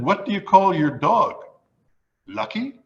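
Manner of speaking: rising pitch on Lucky, mock-innocent; deadpan joke question. Injured man asks blind woman her dog's name, rising. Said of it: "and I said".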